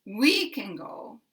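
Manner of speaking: "we" leaps up, then "can go" drops; big stress on WE. Shown as a demonstration. Teacher repeats the emphasis example, heavy stress on "we". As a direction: punch the word "we", let "can go" fall. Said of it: "putting very big stress on".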